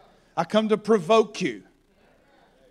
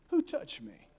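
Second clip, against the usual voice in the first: quiet question; 6 semitones higher, 10 dB quieter than usual. Acted. Preacher acts Jesus asking the crowd.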